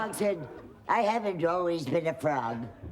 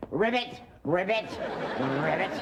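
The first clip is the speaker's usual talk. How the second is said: ordinary delivery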